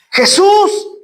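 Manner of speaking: high-pitched call, not full shout; crying out. Acted. Blind man calling to Jesus over the crowd, crying out. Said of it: "a gritar y a dar voces".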